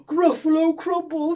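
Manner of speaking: loud, horrified monster exclamation; gasp, then blurt it back in alarm; hammed-up creature panic; frightened echo. Gruffalo repeats it, frightened.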